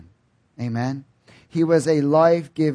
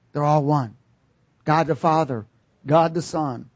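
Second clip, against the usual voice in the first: usual speaking voice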